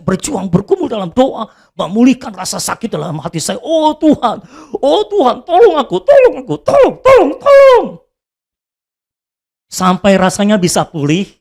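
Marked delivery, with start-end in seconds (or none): 3.6-7.9 rising, louder cries of tolong, higher each time; desperate prayer. Retold or imitated. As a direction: beg God for help, more frantic each cry. (doa putus asa yang makin keras dan tinggi)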